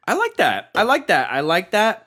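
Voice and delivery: high, loud, forced enthusiasm; trying too hard to convince himself; overselling it. Acted. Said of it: "Who am I trying to convince?".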